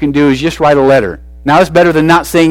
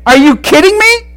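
much higher, louder, incredulous protest; the son outraged at an unfair sentence; indignant disbelief; 4 dB louder than usual. Retold or imitated. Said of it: "In a sense saying".

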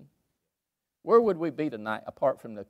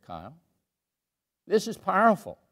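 usual speaking voice

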